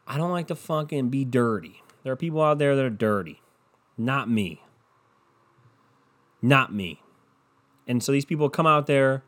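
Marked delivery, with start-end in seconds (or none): none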